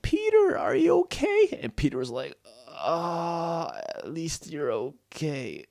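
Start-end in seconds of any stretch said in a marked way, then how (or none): 0.0-1.6 put-on very high, loud girlish squeak; squeaky high character voice, anxious question; worried Stella. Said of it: "And she was like". 2.7-5.6 slow groan trailing into softness; drawn-out, fading words of the badly hurt; injured, fading Peter. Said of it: "and then he passed out".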